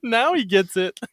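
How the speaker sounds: holding back laughter; trying not to laugh into the mic; stifled giggles. Real, not acted. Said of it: "Why are you trying not to laugh?".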